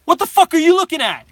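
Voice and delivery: high, loud snapping challenge; loud, high hostile retort; angry confrontation. Retold or imitated. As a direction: confront the staring stranger loudly. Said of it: "I looked at the guy, I'm like".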